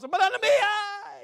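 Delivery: very high drawn-out falling scream; mock-horrified Italian shriek, stretched out; hammed-up Italian scream. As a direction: wail it like jumping off a building. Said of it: "Finally, the Italian's shaking up his lunchbox".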